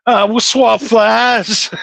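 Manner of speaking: slow, high, hammed-up Southern drawl; Southern accent. Billy Bob answering what boys do down South, in a mock Southern drawl. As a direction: drag it out like a cartoon Southerner.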